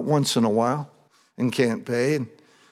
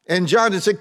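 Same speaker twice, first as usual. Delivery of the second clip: louder, higher, fervent preaching; preaching at full force; 6 semitones higher, 6 dB louder than usual. Acted. Preacher reads and retells the scene to his congregation, fervent. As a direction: preach it with rising fire to the room.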